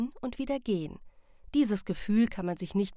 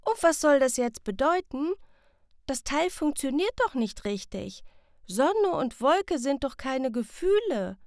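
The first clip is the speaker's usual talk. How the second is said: put-on puzzled child, higher and louder; confused; 7 semitones higher, 5 dB louder than usual. Sam confused by the compass, puzzled and louder. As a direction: protest that the compass is broken. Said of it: "fragt verwirrt".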